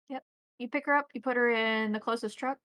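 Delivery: usual speaking voice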